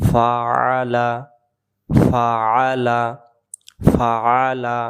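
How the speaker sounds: ফাআলা slowly with the ain kept; slow correction. Shown as a demonstration. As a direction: stretch ফাআলা, keep every letter. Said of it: "একটি অক্ষর বাদ দেওয়া যাবে না".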